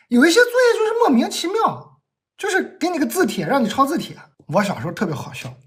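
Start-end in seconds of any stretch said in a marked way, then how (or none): 0.1-4.1 imitating mom's complaining rant, much higher; ranting like a fed-up mother; mom scolding. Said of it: "所以她也会一边写一边骂".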